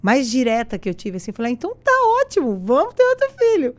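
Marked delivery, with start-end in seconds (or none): 1.5-3.8 much higher, louder and faster burst of delight; joyful yes. Retold or imitated. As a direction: blurt it out overjoyed. Her joyful answer, quoted. (resposta eufórica, bem mais aguda e alta)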